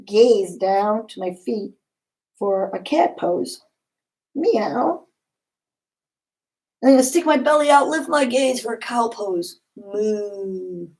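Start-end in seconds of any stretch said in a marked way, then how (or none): 4.3-5.1 high, rising cat meow; cute cat noise for the kids; playful meow. Pretend. Cat pose, kids yoga. 9.8-10.9 put-on quiet cow noise for the kids; soft moo. Cow pose, kids yoga.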